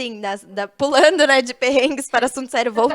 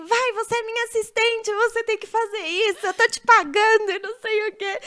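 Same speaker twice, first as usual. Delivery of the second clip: higher, mock bossy makeup artist voice; bossy boss; 7 semitones higher than usual. Assistant mimicking the makeup artist who bossed her, mock bossy and higher. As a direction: play the pushy boss ordering her assistant. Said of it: "E ela falava assim".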